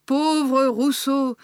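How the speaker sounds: slow, projected lament; drawn-out litany. A narrator's reading. Ingmar Magda continuing her list of writers, slowly lamenting. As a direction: stretch it out like the next name on a list. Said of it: "continuait à égrener Ingmar Magda".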